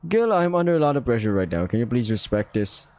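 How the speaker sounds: usual speaking voice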